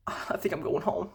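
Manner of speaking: whispered, soft. Retold or imitated. Guy retells date saying she's leaving, whispered. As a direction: breathe it out quietly. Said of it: "she wanna talk about".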